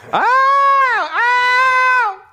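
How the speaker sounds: very high loud yelp; shrieked ow of pain. Real, not acted. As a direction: yelp at a hard bite. Dog bites hard.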